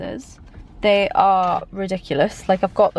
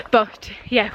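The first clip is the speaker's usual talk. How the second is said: ordinary talk, nothing marked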